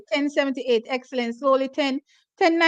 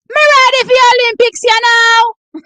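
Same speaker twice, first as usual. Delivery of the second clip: hyped shout; 7 semitones higher, 10 dB louder than usual. Real, not acted. Repeating it, even more hyped.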